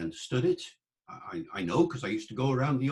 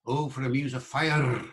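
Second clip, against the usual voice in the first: original pronunciation with the R in "fire" trilled, Scots-like; 2 semitones higher than usual. Shown as a demonstration. Lecturer tries the line with a Scottish-style trilled R. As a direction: roll the R in "fire" hard. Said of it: "it might have been a trilled R".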